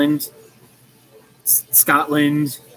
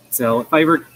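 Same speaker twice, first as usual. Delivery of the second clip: normal talking voice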